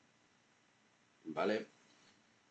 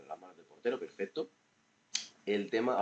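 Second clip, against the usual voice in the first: ordinary talk, nothing marked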